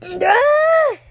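high piercing scream of alarm; cartoon panic yell; loud scream. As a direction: shriek at the top of your lungs. Character screams in the fight.

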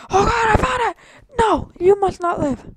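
high, loud panicked yell; no, you must not live; startled shout. Real, not acted. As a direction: shriek it out as the egg appears.

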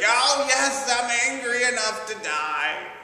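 put-on high, loud teenage whine; whining and complaining. Jonah answers God defiantly, loud teenage whine. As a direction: sulk it out like a teenager. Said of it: "He's just whining and complaining".

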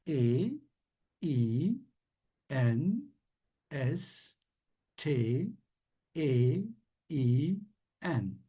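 letters called one by one; spelling out. Shown as a demonstration. Teacher spells Einstein letter by letter for class. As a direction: spell each letter apart, clearly. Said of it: "Peki harfleyelim".

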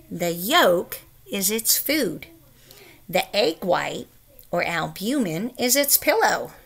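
plain reading voice

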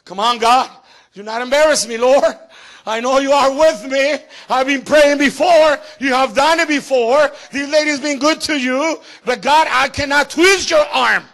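high, loud pleading shout; Elisha pleading with God. Retold or imitated. Preacher voicing Elisha pleading with God, loud. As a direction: beg God out loud, pressing hard. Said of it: "He was like".

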